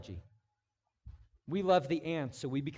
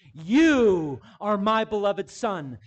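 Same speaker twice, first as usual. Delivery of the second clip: slow, louder solemn proclamation; majestic heavenly voice; 5 semitones higher, 13 dB louder, slower than usual. Retold or imitated. Preacher voicing God's majestic words, slow and solemn. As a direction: intone it with majesty. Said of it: "the majestic voice from on high".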